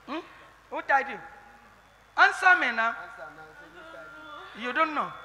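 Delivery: ordinary delivery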